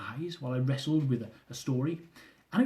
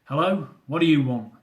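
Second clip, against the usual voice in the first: gruff, louder grumpy answer; disgruntled tone; 6 dB louder than usual. Acted. Husband answering the early call in a put-on disgruntled tone. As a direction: bark it like you hate the caller. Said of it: "I put on my best disgruntled tone".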